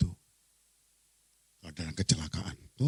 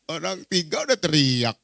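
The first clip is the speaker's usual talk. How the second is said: ordinary talk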